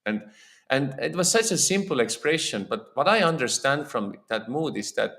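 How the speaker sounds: normal talking voice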